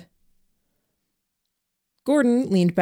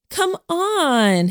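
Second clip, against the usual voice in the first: high echoing plea; 12 semitones higher than usual. A narrator's reading. Helen echoing Lillian to coax Jamie, high whiny plea. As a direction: echo the plea much higher, whiny. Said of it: "Helen echoed her".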